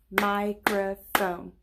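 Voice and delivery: Microphone said slowly in three beats, MI-CRO-PHONE; clapped syllables. Shown as a demonstration. Teacher to kids, slowly clapping out the syllables. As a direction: stretch Microphone out, one clap per part. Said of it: "Did you hear those parts".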